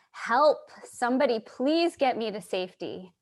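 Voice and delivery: a pleading note from a bottle adrift; mock cry for help. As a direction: call out for rescue, half-acted. Message in a bottle cries out.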